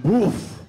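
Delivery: loud, drawn-out "woof"; impact grunt. Retold or imitated. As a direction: mimic the wind knocked out by a blow. Imitates being hit by a bat.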